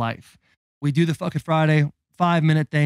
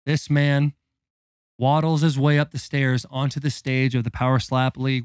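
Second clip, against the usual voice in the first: ordinary delivery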